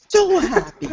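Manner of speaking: very high gushing squeal; high happy voice. Retold or imitated. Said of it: "so happy when they say it".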